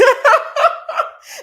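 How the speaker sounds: high, loud laugh; real, high-pitched cackling laugh; burst of laughter. Real, not acted. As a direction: laugh out loud in a rising peal.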